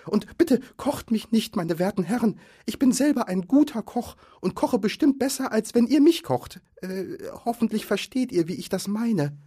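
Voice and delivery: high, frightened hobbit plea; Bilbo pleading. A narrator's reading. Hobbit begging trolls not to cook him, high pleading. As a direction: beg nervously for your life.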